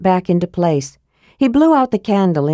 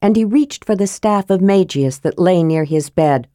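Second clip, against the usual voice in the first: ordinary delivery, nothing marked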